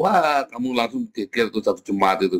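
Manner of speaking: usual speaking voice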